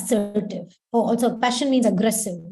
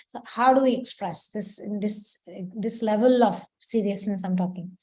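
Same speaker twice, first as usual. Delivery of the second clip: ordinary talk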